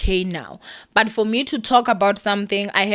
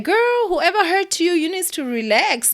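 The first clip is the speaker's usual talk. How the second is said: dismissive sass; 9 semitones higher than usual. Retold or imitated. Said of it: "I was just like".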